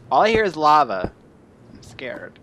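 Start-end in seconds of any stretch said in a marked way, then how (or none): 1.7-2.4 whisper. Pretend. Alone in dark cave hearing lava.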